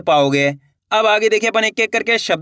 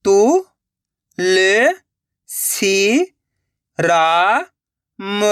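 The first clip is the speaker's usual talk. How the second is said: syllable by syllable; much slower than usual. Shown as a demonstration. Teacher sounding out a word for learners, syllable by syllable. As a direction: separate drawn-out syllables with long gaps. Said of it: "एक एक अक्षर का उच्चारण करके".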